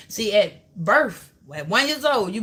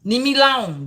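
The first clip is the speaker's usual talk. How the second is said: put-on a two-year-old yelling, louder by the end; a toddler shoving you off; hammed-up toddler yelling leave me alone; mock toddler; 4 dB louder, slower than usual. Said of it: "that's what the two-year-old said".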